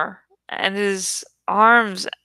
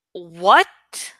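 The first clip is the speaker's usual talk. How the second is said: demanding; 3 semitones lower than usual. A narrator's reading. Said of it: "Jake demanded".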